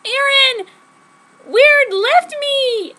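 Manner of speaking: very high, loud, drawn-out wail; slow anguished shriek; screaming. Acted. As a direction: scream it down the phone in despair. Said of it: "I screamed down into the phone".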